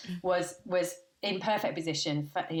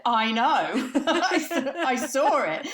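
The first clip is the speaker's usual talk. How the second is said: laughing; 7 semitones higher, 7 dB louder, much slower than usual. Retold or imitated. Retelling her reply, laughing.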